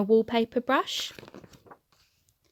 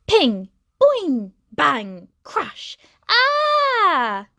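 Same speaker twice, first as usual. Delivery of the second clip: loud high crash noises ending in a yelp; boing, bang, crash, ah, loud and high; sound effects crash and yell; 5 semitones higher, 6 dB louder, much slower than usual. A narrator's reading. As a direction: play the crash, then a shriek. Bicycle falling apart.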